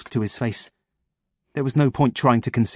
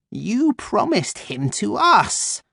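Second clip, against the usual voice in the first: crow voice; 10 semitones higher, much slower than usual. Acted. Crow Freya, slow and strange.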